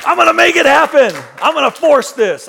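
loud, pushy, hammed-up determination; mock tough-guy push; forceful mock shout. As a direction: bark it like you'll force it through. Mocking forceful pushing.

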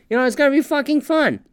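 ordinary talk, nothing marked

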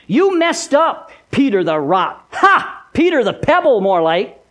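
high-pitched, mocking accuser; taunting Satan. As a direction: jeer and condemn, hitting the key words. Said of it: "the field day that Satan had with Peter".

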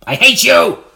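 loud, high snarl of spite; milk's hateful cry. A narrator's reading. Sour milk shouting at William, loud and high. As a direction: spit the hate out like a furious jug of milk. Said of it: "said the milk".

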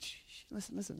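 soft unvoiced whisper; hushing whisper. Jesus calming the sobbing woman, whispered hush. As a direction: hush her gently.

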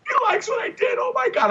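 squealing high with excitement, like a starstruck fan; giddy fan squeal; fangirling. Retold or imitated. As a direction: gush over meeting your hero. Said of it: "I'm fangirling now".

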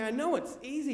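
high-pitched protest, leaning on KNOW. Retold or imitated. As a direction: push back at dad in full voice. Protesting to dad's whispered urging.

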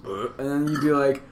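usual speaking voice

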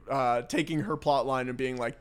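ordinary talk, nothing marked

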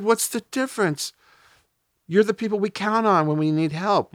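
high-pitched, incredulous retort; indignant protest. Retold or imitated. Friend challenging the ambulance crew, high and indignant.

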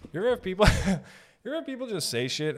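normal talking voice

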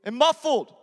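loud, driving altar-call preaching; preaching at full force. Acted. Pastor urging the congregation to accept Jesus, full-force preaching. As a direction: press the room hard toward a decision.

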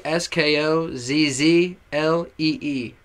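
letters spelled out slowly, one by one; slow spelling. Shown as a demonstration. Slow letter by letter. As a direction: rapper spelling his handle for fans, slow and clear. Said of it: "spelling out his handle".

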